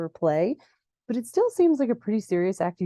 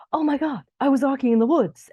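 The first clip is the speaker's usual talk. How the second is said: high, animated retold exclamation; louder, higher-pitched mock shock; mock astonishment; 7 semitones higher, 4 dB louder than usual. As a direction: imitate someone reacting in shock, pitch jumping up. Adam reacts to the news.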